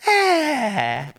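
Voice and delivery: high, mock off-key "ah"; hammed-up high tuneless wail; bad singing screech. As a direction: imitate a gremlin who can't sing, breaking into laughter. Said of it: "she just can't sing".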